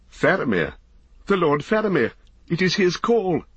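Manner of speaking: high, ringing cry of recognition; excited high exclamation; cried out. A narrator's reading. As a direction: call out the captain's arrival, pitch soaring. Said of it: "cried Beregond".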